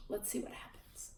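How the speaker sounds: soft secret whisper; hushed teasing aside. A narrator's reading. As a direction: lean in and whisper it. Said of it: "teasing aside to listeners".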